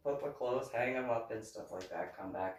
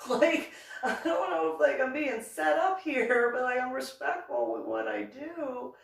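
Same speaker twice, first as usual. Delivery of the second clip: higher and louder, laughing through it; laughing nervously; 10 semitones higher, 7 dB louder than usual. Real, not acted. Vlogger laughing as she tells the bikers she's respectful. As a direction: laugh it off while defending herself. Laughing, defensive about being set up.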